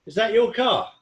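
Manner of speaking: playfully admiring the car; flirty admiring question.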